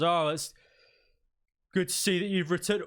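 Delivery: lightly acted military welcome; greeting sergeant. Sergeant greets the returning party.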